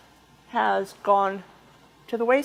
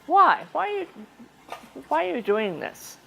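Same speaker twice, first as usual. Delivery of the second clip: mock-puzzled, higher-pitched "why"; imitated puzzled questioning; 6 semitones higher than usual. Sister questioning why the speaker attends meetings, imitated puzzlement. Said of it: "she wonders".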